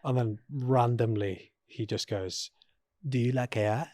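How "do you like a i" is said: Cartman-like silly voice; imitating Boris Johnson's odd voice; Boris impression. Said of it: "What was that?".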